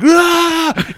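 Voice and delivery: high-pitched imitated yell; mock yell. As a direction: act out a wild "Ah!" way up high. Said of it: "just starts yelling".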